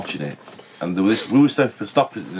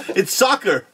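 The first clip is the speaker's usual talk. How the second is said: much higher, odd sing-song "It's soccer"; weird put-on voice; 11 semitones higher, slower than usual. Retelling his own awkward bar voice, much higher and odd. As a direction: mimic your own strange tourist voice. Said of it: "I went really weird when".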